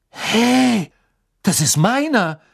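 hoch und laut, überrascht; empört aufschreien; Harry empört. A narrator's reading. Harry protests. (überraschter Junge)

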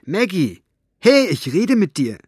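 loud, high call for attention. Acted. Aunt loudly demanding the girl's attention. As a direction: snap her out of it. Elinor calls out, shaking her.

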